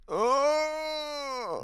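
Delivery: sudden high cry of pain. Shown as a demonstration. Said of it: "I wake up like this".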